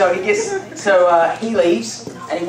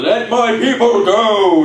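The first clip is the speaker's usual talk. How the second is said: slow, loud, grand demand; commanding; 4 semitones higher, 5 dB louder, much slower than usual. Retold or imitated. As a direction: proclaim it like a prophet. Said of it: "He probably said it more like".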